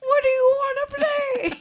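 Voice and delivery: high, loud shaky leprechaun; laughing leprechaun squeal. Pretend. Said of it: "If people could see me right now".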